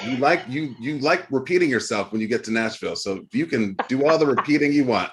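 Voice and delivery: ordinary talk, nothing marked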